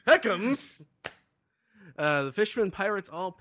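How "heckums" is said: high loud character blurt; squeaky cartoon shout; cartoon exclamation. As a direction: yelp it out.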